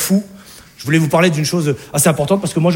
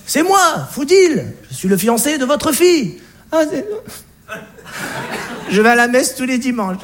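nervous fiancé; 5 semitones higher than usual. Acted. Fiancé calming the mother.

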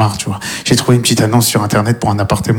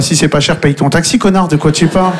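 ordinary talk

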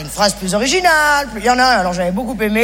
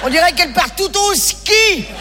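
slow, higher, giggling; laughing jab; 6 semitones higher, slower than usual. Acted. Shop clerk mocking a customer, laughing. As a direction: snicker through the joke.

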